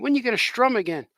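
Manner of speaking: high, pestering mock critic; heckling critic. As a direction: needle him in a put-on high voice. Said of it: "He said".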